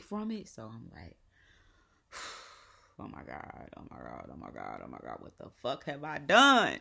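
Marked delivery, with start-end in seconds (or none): 3.0-5.2 low, hushed, repeated dread; muttered mock panic. After Googling horror stories, hushed acted panic. 5.2-6.8 loud shout breaking into laughter; acted panicked outburst. Realizing the mistake after Googling, laughing panicked shout.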